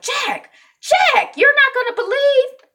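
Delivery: very high, loud holler; calling out. Retold or imitated. Wife calling to her husband with news, high and loud. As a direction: call excitedly to someone in another room.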